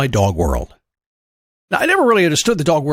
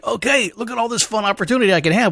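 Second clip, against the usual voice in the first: lightly voiced eager dog, pitched higher; Levi; 7 semitones higher than usual. Dog coming out of tunnel, lightly acted eagerness. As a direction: play a dog taking in all the fun options. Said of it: "He's like".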